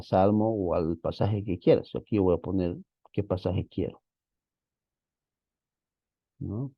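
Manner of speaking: normal talking voice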